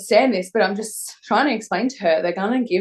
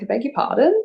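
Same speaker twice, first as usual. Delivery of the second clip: higher-pitched, rising challenge; affronted disbelief; 6 semitones higher than usual. Retold or imitated. Patient told she can't leave, retold indignant question. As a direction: query it sharply, pitch climbing at the end.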